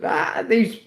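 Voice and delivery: normal talking voice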